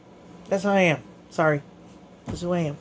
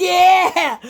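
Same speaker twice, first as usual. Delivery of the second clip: high, loud triumphant cry; victory yell; 10 semitones higher, 5 dB louder than usual. Real, not acted. Winner grabbing his teammates after the fight, yelling. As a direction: let the win burst out.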